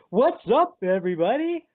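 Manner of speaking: high, hammed-up showman voice; exaggerated, high-pitched buddy act; Shazam hamming it up. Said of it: "just totally hamming it up".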